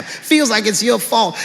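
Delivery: ordinary delivery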